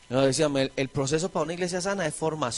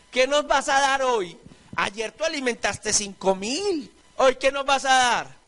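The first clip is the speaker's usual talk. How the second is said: demanding crowd; 7 semitones higher, slower than usual. Retold or imitated. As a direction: act the greedy crowd pushing Jesus for more food. Crowd demanding bread from Jesus.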